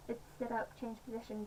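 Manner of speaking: ordinary talk, nothing marked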